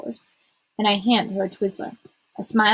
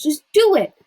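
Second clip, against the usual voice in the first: higher, louder push to act; insistent demand; 7 semitones higher, 8 dB louder than usual. A narrator's reading. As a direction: demand it impatiently. Friend insists during quarrel.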